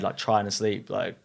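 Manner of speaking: normal talking voice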